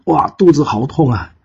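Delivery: cry of pain. Acted. Bird immortal doubled over, a hammed-up cry of pain. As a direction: wail out the bellyache. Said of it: "痛得在地上打滚".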